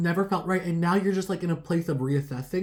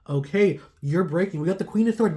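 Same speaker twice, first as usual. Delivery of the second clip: normal talking voice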